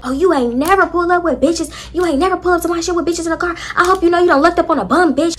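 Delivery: a fast, high-pitched mock tirade. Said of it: "Jaleesa was like".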